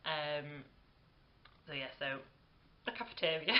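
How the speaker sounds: ordinary talk, nothing marked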